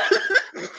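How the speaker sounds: high squealing laugh; helpless high laugh at the clip. Real, not acted. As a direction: break into a shrieking laugh.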